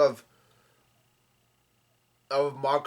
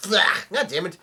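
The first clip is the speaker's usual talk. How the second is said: higher, louder mock yell; retold yell; 6 semitones higher, 5 dB louder than usual. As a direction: yell it out as retold character dialogue. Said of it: "Luffy yells out".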